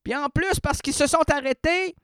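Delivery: high-pitched, incredulous outrage; rising indignation. Real, not acted. Customer fuming over customs fees on a parcel, indignant. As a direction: let the exasperation push the voice up high. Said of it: "que ma rogne prend son essor".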